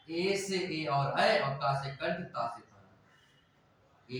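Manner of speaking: each syllable of Ekta drawn out very slowly; slow mnemonic spelling-out. Shown as a demonstration. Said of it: "इसको एक सूत्र और है एकता".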